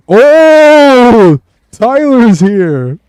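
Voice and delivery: high, loud, drawn-out character greeting; excited shout. Acted. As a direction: burst out in a booming put-on voice to welcome Tyler. Character greets Tyler loudly.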